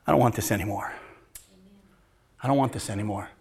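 soft repentant inner voice; quiet realization. Retold or imitated. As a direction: say it to yourself softly. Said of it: "where you realize".

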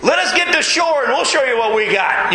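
mock fisherman bravado, spoiling for a fight; tough challenge. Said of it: "rough and tough Peter's thinking".

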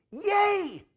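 high, loud squeal of delight; excited cheer. Retold or imitated. Nurse cheering when she hears he preaches: high excited squeal. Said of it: "She got excited".

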